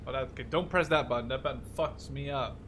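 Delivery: ordinary delivery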